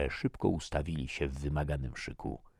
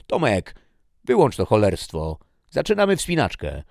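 loud, high call to get moving; shouted order; 6 semitones higher, 8 dB louder than usual. Acted. Soldier Carlsen calling Tomek to start the climb, shouting. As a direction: bark the order across the camp.

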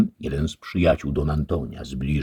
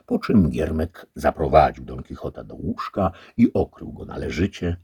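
plain reading voice

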